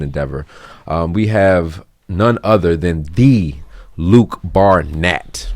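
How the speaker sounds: loud, projected professional host intro; radio voice. Real, not acted. Host introduces close friend as guest, radio voice. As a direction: announce the guest in a big radio voice. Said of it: "you put on your radio voice".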